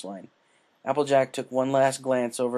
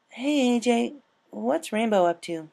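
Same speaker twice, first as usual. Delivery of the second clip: put-on higher, curious young mare voice; Twilight's voice; 12 semitones higher, 4 dB louder than usual. Twilight greeting Applejack in the orchard, curious and higher. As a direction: ask brightly as a friendly bookish pony.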